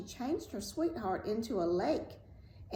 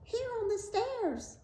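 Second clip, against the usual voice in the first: very high little voice; tiny, high-pitched talking blood drop; answering drop of blood; 14 semitones higher than usual. A narrator's reading.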